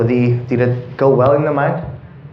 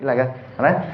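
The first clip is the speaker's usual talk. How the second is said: ordinary talk, nothing marked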